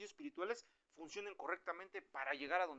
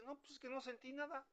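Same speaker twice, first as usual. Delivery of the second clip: much higher, softer put-on voice; mock complaining worshipper; 9 semitones higher, 5 dB quieter than usual. A worshipper says they felt nothing, in a high mock complaint. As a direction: imitate an unmoved churchgoer up high.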